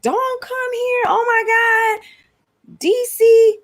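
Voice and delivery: very high-pitched, drawn-out mock voice; imitating Texan YouTuber. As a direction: imitate an over-the-top young Texan woman complaining. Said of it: "So she was like".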